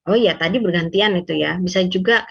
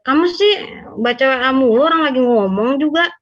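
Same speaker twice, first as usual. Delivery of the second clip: higher-pitched, scolding complaint; accusing; 5 semitones higher, 3 dB louder than usual. Shown as a demonstration. Partner scolding the other for reading chats mid-talk, accusing. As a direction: scold them with raised pitch and voice. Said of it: "dibandingkan gini".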